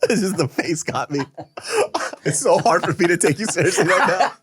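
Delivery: high-pitched speech through real laughter; can't keep a straight face; fit of giggles. Real, not acted. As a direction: struggle to get words out while giggling. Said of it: "I gotta get these giggles out".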